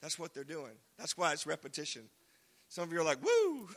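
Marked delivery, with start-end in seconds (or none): 3.2-3.7 high, loud "woo"; quick pitched-up cheer; mock whoop. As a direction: imitate an excited crowd member whooping. Imitates listeners reacting.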